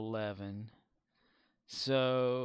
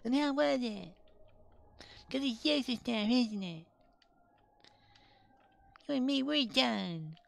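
put-on changed child voice; 13 semitones higher, 4 dB louder than usual. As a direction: speak like a small child actor. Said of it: "change the voice and go".

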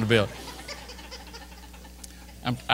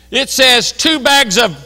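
loud, high, slowly punched words; indignant shout; 10 semitones higher, 12 dB louder, much slower than usual. Retold or imitated. Clerk reads back disputed receipt, indignant shout. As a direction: read it out at full indignant volume.

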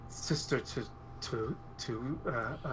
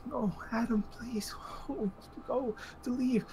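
higher, desperate plea to Adam; high pleading woman; 8 semitones higher than usual. Acted. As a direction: beg him in a raised, tense voice. GM voices NPC pleading.

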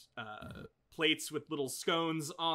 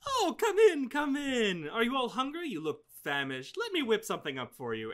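higher, fussing grandmotherly voice, lightly done; doting old hostess; 10 semitones higher, 5 dB louder, faster than usual. Acted. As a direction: fuss over the guests like a doting grandma. GM voices Ruth welcoming guests.

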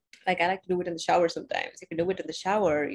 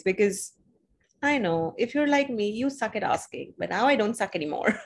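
ordinary talk

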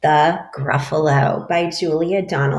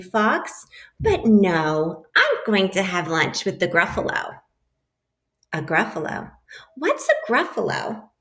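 lively picture-book read-aloud; animated storybook voice for a class; reading to children; 4 semitones higher than usual. A narrator's reading. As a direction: read it like story time for little kids.

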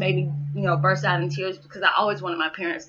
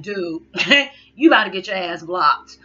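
louder, laughing through a playful threat; laughing mock warning; 7 dB louder than usual. To a late-night texter, a loud laughing warning. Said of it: "I'm like".

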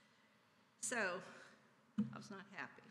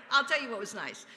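repeated much louder after a laugh; louder restart; 2 semitones higher, 11 dB louder than usual. Real, not acted. Speaker to the congregation, laughing it off and repeating it loud. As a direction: say it again, punching it out to the room.

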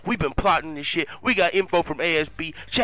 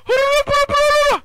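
very high, loud wordless whoop; imitated charging holler; 7 dB louder than usual. Player charging out of the bathroom, wild high holler. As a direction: let out a wild high battle cry. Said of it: "comes charging out the fucking bathroom like".

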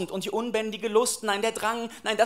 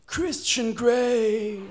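very slow, stretched-out introduction; drawn-out name reveal; much slower than usual. Acted. Detective's mistaken name turns seducer, drawn-out reveal. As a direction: savour the name, stretching every syllable.

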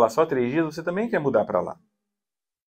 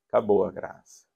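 ordinary talk, nothing marked

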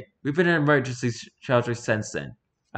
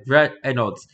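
normal talking voice

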